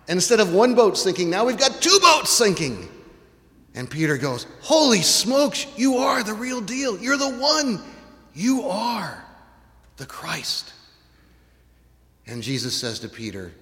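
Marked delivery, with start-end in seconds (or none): 4.6-10.7 awed exclamation. Acted. Peter awed by the catch.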